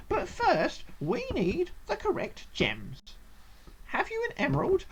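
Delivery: high, swooping gnome voice; cheerful gnome trial master. Acted. Gnome explaining the gem lock, high theatrical voice.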